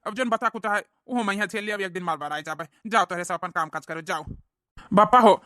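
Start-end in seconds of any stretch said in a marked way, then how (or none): 4.9-5.4 loud call to father. Acted. Son calling his father loudly.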